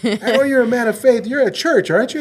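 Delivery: high, loud disbelieving question; mock incredulous coworker. Atheist coworker questioning, retold.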